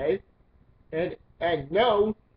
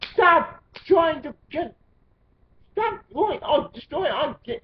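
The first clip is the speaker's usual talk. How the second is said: exasperated yell; 9 semitones higher, 4 dB louder than usual. Real, not acted. Ranting at petition signers, voice rising into a strained yell. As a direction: shout in frustration, voice cracking up high.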